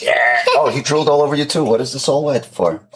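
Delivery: usual speaking voice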